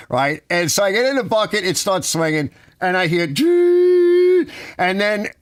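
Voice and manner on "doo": high, held "doo" like a motor starting up; imitated machine hum. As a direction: hum the lift kicking in, high and loud. Bucket truck starting up.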